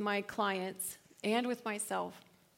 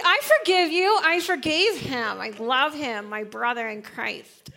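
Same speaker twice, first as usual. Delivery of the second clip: fake forgiveness; 6 dB louder than usual. Acting out fake church forgiveness.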